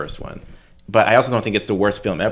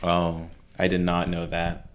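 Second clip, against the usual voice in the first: slow, softly feigned surprise; mock-earnest ignorance; sarcastic; 3 semitones lower, 6 dB quieter, slower than usual. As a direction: play dumb, drawing it out quietly. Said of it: "I was being sarcasm".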